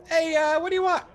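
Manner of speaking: very high, loud gnome voice; gnomish barmaid. Acted. Gnome barmaid asks the customer for an order, high-pitched gnome voice. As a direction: pipe up loudly as a tiny gnome woman. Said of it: "she looks at you and goes".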